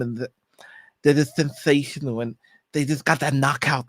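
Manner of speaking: Mike Tyson. Acted. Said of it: "shall I help a Mike Tyson one".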